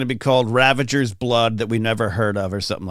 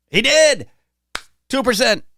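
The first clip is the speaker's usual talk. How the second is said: excited cheer; 11 semitones higher, 4 dB louder than usual. Real, not acted. Guessed right live on air.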